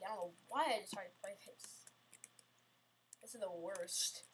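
fast whispered grumble; muttering regret under the breath; frustrated whisper. Real, not acted. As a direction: hiss it to yourself in defeat. Grumbling at the obby.

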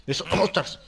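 loud startled shouting; startled yell. Real, not acted. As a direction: yell at the mob to back off. Mob attacks, player yells.

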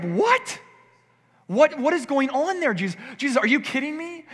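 stunned disciples asking Jesus; disciples' shocked disbelief. Acted. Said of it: "they're like".

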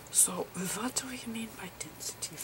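soft unvoiced whisper, explaining gently; whispered lecture. Lecturer explaining photon slices to viewers, whispering.

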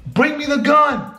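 high, urgent mock yell; retold screaming. Yelling to Brian to scare a robber, mock screaming. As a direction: bluff an intruder with a high-pitched yelled order. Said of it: "And so I start screaming".

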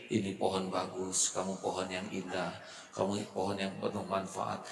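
ordinary delivery

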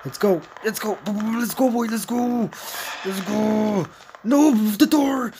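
high, loud panicked cheering on; fleeing the chaser, high frantic shouts; frantic urging. Real, not acted. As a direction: yell it while running for your life. Fleeing the monster, urging self.

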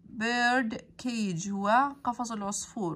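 ordinary talk

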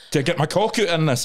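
laughing, high mock actor asking; giggling through a cheeky actor's question. Actor reading a new script, laughing mock question.

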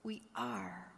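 hushed whispered declaration. Acted. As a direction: breathe it out softly. Quiet summing up of sermon point.

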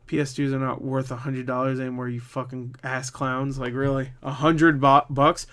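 ordinary talk, nothing marked

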